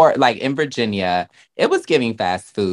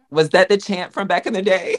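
laughing question; 11 semitones higher, faster than usual. Real, not acted.

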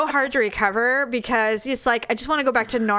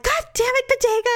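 high-pitched retold cursing at the dog; exasperated scolding; 13 semitones higher, faster than usual. Woman retelling her fall, scolding the dog in exasperation. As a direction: exclaim it high, blaming the dog.

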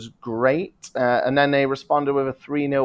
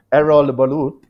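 Erol Bulut in a put-on foreign accent, louder and rolled; 3 semitones higher, 7 dB louder than usual. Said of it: "it was just like a foreign accent".

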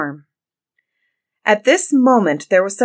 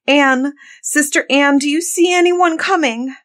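high, anxious call, voice raised; crying out; 8 semitones higher, 5 dB louder than usual. A narrator's reading. Afflicted wife calling to her sister on the tower, crying out. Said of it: "cried out from time to time".

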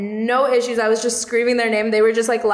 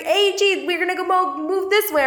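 high, laughing bullhorn call; screaming instructions; 7 semitones higher than usual. Retold or imitated. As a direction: herd the team along with a shout.

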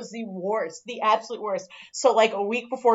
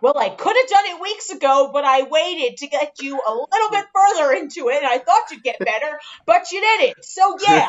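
higher, louder boss voice, hammed-up; mock boss; 5 semitones higher, 4 dB louder than usual. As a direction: doe de baas na, luid en hoog. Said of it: "And he was like".

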